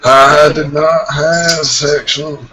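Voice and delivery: Clinton. Acted. Friend attempts the famous denial on request, Clinton impression. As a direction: draw out the words like a denying president. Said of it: "What about Clinton?".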